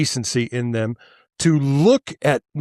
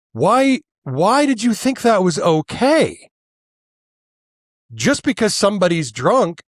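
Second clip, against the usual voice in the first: raised, heated questioning; real anger boiling up; angry; 6 semitones higher than usual. Real, not acted. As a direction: demand an answer, furious. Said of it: "I'm angry".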